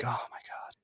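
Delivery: mock whispered dismay; hushed dismay. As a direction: breathe it out under your breath, very softly. Retold dismayed realization to himself.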